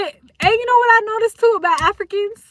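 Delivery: high, loud burst of excitement; excited. Real, not acted. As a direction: jump in excitedly with the new idea. Excited new point.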